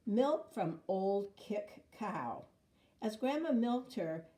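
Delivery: plain reading voice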